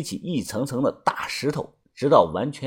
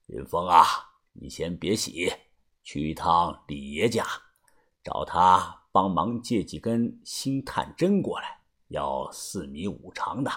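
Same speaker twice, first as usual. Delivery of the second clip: put-on low, soft elderly voice; low hushed elder voice; old boss; 8 semitones lower, 8 dB quieter than usual. A narrator's reading. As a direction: give quiet orders to the young man. Old boss gives orders.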